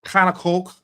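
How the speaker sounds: loud, badly faked Scouse; clumsy imitation of Scouse; attempted Scouse. Shown as a demonstration. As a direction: take a stab at a Scouse accent. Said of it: "but Scouse is too hard".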